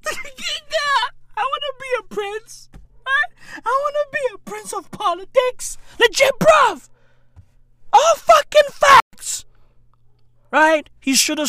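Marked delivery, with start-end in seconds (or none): 0.1-9.4 higher, pleading spoiled voice; mock whiny prince. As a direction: whine like an entitled heir. Whiny high mock persona.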